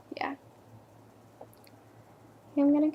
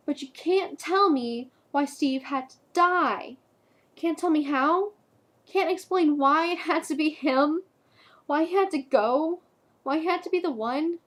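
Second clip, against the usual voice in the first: anguished protest; 3 semitones higher than usual. Acted. Girlfriend demanding why Steve had to die, anguished protest. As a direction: protest the unfairness in acted anguish.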